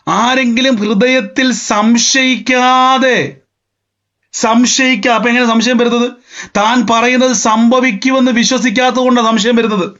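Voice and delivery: high, stretched scripture declamation; impassioned preaching. Real, not acted. Preacher expounding Mark on doubting in the heart, impassioned.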